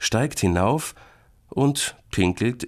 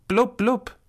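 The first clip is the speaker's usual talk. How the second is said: very high bubble noise; imitated bubbling; 4 dB louder than usual. Boy imitates the noise from the bathtub, high blubs. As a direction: make high little blub sounds. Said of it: "Es klang wie".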